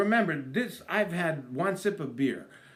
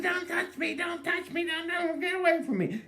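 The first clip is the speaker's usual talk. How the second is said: high sing-song don't touch me; mock wife. Wife shrinking away from husband in public, high sing-song whine.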